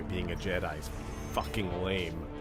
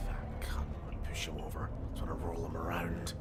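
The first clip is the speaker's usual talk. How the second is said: whispering; 4 semitones lower, 6 dB quieter than usual. Real, not acted. Muttered aside to teammate.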